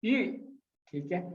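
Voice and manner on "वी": वी short, falling from high; fourth tone. Shown as a demonstration. Teacher demonstrating four tones, falling tone on वी. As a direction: drop वी sharply from the top. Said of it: "अदायगी चारों tones की".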